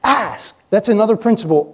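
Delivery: usual speaking voice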